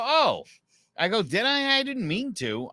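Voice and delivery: high-pitched retold apology; feigned surprise turning apologetic; mock innocent. Apologizing to the angry neighbor.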